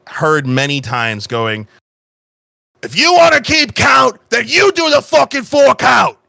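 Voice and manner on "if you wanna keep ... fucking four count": high, loud bandmate yelling; exasperated drummer's full-voiced shout; angry shout. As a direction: bellow it across band practice. Drummer's remembered band practice outburst.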